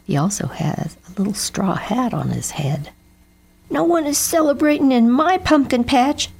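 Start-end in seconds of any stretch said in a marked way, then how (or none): 3.7-6.3 high, louder yell. Acted. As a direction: shout him down. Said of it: "Jeb yelled at Bernie Crow".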